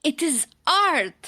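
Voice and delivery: high, loud, proud; defiant. Acted. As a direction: stand your ground. Daughter defiant.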